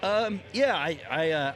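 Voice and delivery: choked up, voice wobbling; shaky voice. Real, not acted. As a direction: voice trembling after crying. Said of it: "so, uh, shaky voice, sorry".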